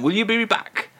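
ordinary delivery

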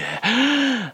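a quick, high theatrical gasp; a hammed-up intake of breath; sharp gasp. [name] gasping dramatically as he is asked again. [name] reacts before the phrase.

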